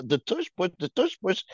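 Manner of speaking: mimicking the phrase in a lightly teasing lilt; mocking flirty imitation. Ribbing the co-host over the tush push, mock-flirty. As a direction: say it as a mocking come-on. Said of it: "you sound like a little hoe".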